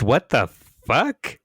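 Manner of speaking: loud, slow stunned exclamation; shocked disbelief. Real, not acted. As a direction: blurt it out in disbelief. Reacting to shocking photo.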